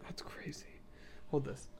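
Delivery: breathy hushed aside, no voice at all; whisper. Real, not acted. Host whispering an aside to co-host, hushed. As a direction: whisper it so the camera barely catches it.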